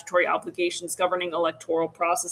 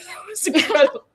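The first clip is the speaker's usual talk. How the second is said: higher, laughing through the words; 8 semitones higher, faster than usual. Real, not acted. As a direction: laugh it out, amused. Laughs at own slip.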